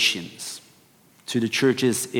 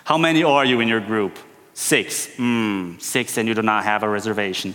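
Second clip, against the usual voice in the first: eye-rolling waiter; 4 semitones lower than usual. Retold or imitated. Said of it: "you can almost hear his eyes rolling".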